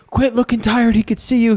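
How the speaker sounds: high-pitched, loud coaching yell; trainer yelling. Retold or imitated. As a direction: yell it like a corner trainer between rounds. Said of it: "slaps him and goes".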